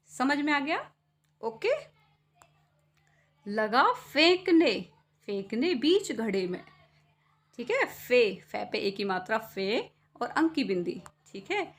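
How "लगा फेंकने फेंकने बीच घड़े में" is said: poem recitation. Acted. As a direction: declaim the poem line. Poem line. (ऊँची आवाज़ में धीमा कविता पाठ)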